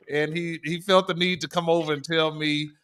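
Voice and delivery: normal talking voice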